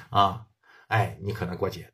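ordinary delivery, nothing marked

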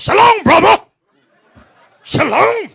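high, booming shouted greeting; dominant sister impression. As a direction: belt it out high and overpowering. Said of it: "She goes".

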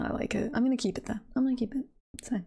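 normal talking voice

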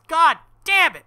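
loud, high, real exasperated yell; frustrated shout. Real, not acted. Reader sick of the word sheath, loud frustrated yell. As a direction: explode in frustration.

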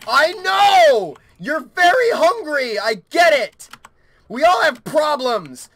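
loud, high frustrated shouting; exasperated yell. Real, not acted. Player yelling at the very hungry gremlin, exasperated shout. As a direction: yell back, fed up, voice pushed way up.

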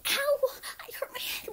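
usual speaking voice

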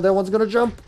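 alarmed warning. Real, not acted. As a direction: warn them it's about to jump. Warning about zombie leap.

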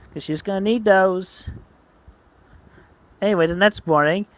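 ordinary delivery

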